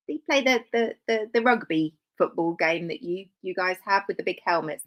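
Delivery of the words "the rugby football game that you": ordinary delivery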